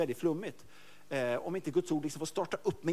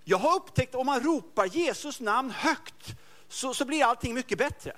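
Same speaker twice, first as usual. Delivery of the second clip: quoted intercessor; 7 semitones higher, 3 dB louder, slower than usual. Retold or imitated. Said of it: "Hon skrev bara så här".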